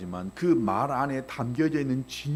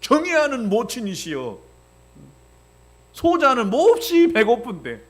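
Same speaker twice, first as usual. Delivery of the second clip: pompous archaic address; 6 semitones higher, 5 dB louder than usual. Retold or imitated. Pastor mocking a flowery way to ask mom for food. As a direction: ham up a flowery courtly plea. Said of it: "미사성어가 필요하겠어요".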